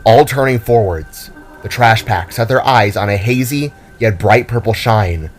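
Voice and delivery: ordinary delivery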